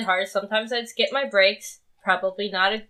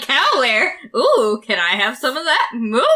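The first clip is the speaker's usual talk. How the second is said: bright, eager voice with a loud moo; eager mock cow fan; 3 semitones higher, 4 dB louder than usual. Teasing the friend about cow wear, hammed-up eager moo. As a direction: ham up an overexcited friend. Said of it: "He's like".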